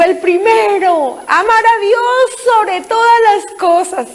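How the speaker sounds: high, slow, showy recitation; grand speech. Retold or imitated. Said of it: "pensaba hacerme un discurso".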